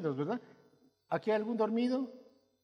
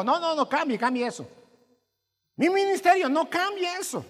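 loud, higher insistent no no no; forceful pulpit correction; emphatic correction; 11 semitones higher, 9 dB louder than usual. Real, not acted. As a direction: call it out firmly from the pulpit. Preacher correcting congregation loudly.

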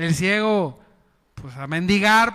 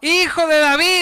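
louder, higher cry; calling out; 8 semitones higher, 5 dB louder than usual. Shown as a demonstration. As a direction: call it out as the blind man. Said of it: "diga conmigo en voz alta".

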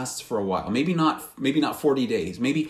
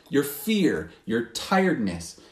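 usual speaking voice

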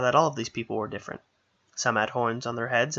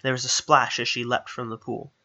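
plain reading voice

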